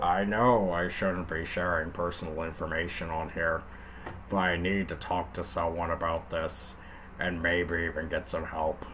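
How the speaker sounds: a hint of a gruff cop reading; Linkara cop voice. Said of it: "I'll just do Linkara".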